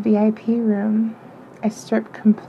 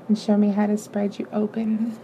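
ordinary delivery, nothing marked

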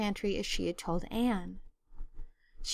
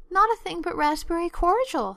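sobbing; 8 semitones higher, 5 dB louder than usual. A narrator's reading. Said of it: "sobbed Anne".

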